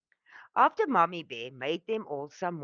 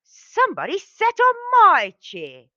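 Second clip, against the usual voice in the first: much higher, louder shrieking bear voice; Mommy Bear roar; 10 dB louder than usual. A narrator's reading. Mommy Bear finds her chair used, roaring high and loud. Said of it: "Mommy Bear roared".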